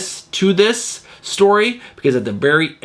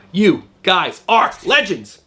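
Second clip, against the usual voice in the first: ordinary talk, nothing marked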